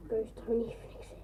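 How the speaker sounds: whispered aside; whispering. Real, not acted. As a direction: say it under your breath, voiceless.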